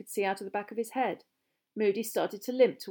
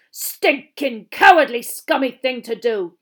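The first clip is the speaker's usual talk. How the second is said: loud, higher, scornful growl; angry berating; 4 semitones higher, 14 dB louder, slower than usual. Acted. Teacher berating the boy who attacked, angrily. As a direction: spit out each insult forcefully.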